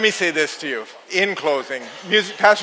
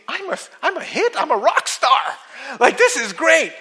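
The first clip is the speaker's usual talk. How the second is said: delighted thought; 8 semitones higher than usual. Acted. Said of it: "And he thinks".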